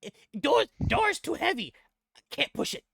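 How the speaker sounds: high, loud goblin voice, straining; struggling goblin. Acted. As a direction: strain against a door that won't budge. Goblin struggling with heavy door.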